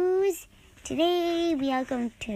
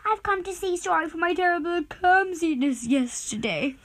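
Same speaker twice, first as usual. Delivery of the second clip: Mister Clumsy voice; 8 semitones higher, 3 dB louder than usual. Acted. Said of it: "he said".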